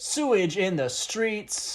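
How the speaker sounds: slow, loud, high declaiming; mock slam poet belting out a line; poetry slam. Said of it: "what would that sound like".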